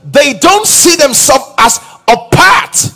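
loud, high, driving sermon delivery; fervent preaching. Acted. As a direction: preach it out to the whole hall, rising. Preacher building to full force.

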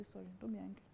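soft apology. Retold or imitated. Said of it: "I say".

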